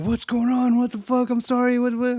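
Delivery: high, panicked yelps; a boyfriend startled awake, squeaking in panic. Retold or imitated. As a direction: freak out in a high, rattled voice. Boyfriend panicking at creepy girlfriend.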